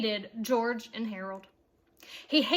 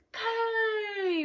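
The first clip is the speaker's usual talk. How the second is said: crowd answering cheer; 8 semitones higher than usual. A narrator's reading. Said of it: "repeated the fans".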